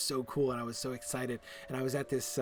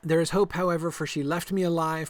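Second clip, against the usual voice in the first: higher, louder, declaiming the line; dramatic quest reading; 4 semitones higher, 6 dB louder than usual. Acted. Voicing Lyrdon's quest text, stern and declaiming. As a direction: read it like a stern hero. Reads character's line aloud.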